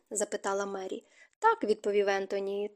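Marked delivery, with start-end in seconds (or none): none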